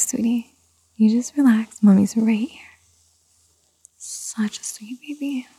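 soft bedtime hush; lulling whisper. Acted. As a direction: whisper gently so they drift off.

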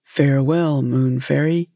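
lightly voiced fisherman, low pitch; casual low farewell, a hint of the fisherman. A narrator's reading. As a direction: lightly play an unmoved fisherman. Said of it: "said the fisherman".

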